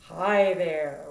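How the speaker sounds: low chest-voice hi there. Shown as a demonstration. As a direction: let it rumble low in the chest. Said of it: "right here".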